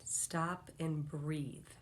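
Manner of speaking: soft, very slow calming voice; slow them down gently; calm breathing guide. Shown as a demonstration. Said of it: "take a deep breath with me".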